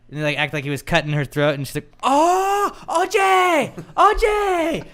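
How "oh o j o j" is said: very high, loud drawn-out squeal; hammed-up falsetto delight; excited recognition. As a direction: imitate a woman squealing as it dawns on her. Woman suddenly recognizes O J.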